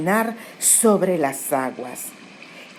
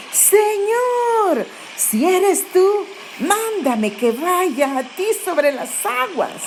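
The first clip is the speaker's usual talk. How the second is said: much higher eager call across the water, slower at the end; 11 semitones higher, slower than usual. Retold or imitated. Peter from the boat calls out to Jesus on the water, high and eager. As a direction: lift the voice high as if shouting to someone far off. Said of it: "Pedro le gritó y le dijo".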